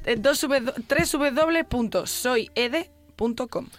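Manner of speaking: high and loud, drawn out; giddy, wired on coffee; too much caffeine. Real, not acted. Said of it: "ya me he tomado dos cafés".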